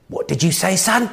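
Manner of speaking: imitating a teacher taken aback; affronted teacher. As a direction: challenge the pupil, voice climbing to the last word. Voicing the affronted English teacher.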